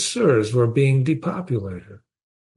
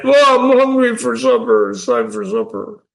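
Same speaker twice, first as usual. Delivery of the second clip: high, put-on whine about being hungry; exaggerated high-pitched mock hungry voice; mock supper-time complainer; 11 semitones higher than usual. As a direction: act out a trivial hunger in a much higher voice. Mock everyday hunger, not real starvation.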